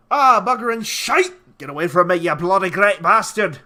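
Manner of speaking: loud, high mock Scottish outburst; hammed-up Scottish shout; Scottish-ish accent. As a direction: curse in a rough-guess Scottish accent. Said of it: "I don't know what accent I was just doing".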